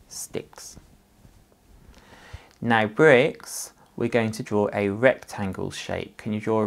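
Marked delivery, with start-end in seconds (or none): 0.1-0.8 whispered word. Shown as a demonstration. Teacher whispering a demo word to children. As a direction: breathe the word without voice.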